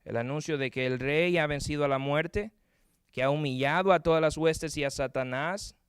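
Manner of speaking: ordinary delivery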